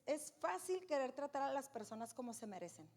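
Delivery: ordinary delivery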